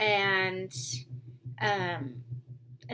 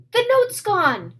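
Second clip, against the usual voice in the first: high, loud, slow alarm; startled cry about the missing note; alarmed announcement; 12 semitones higher, 9 dB louder than usual. Acted. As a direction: announce the discovery in shock. Simon discovers the missing note.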